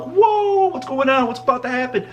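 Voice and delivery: high, long whoa of astonishment; mock alarm. Bystander seeing a subway fight start, high-pitched alarm. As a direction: act out wide-eyed shock, voice shooting up. Teller acts own wide-eyed shock.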